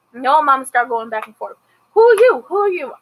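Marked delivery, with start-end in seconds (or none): 1.9-2.9 high, loud shout of who are you; a hammed-up catfight yell; mock angry shouting. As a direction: yell it in her face, indignant. Star and Noah's mother fighting.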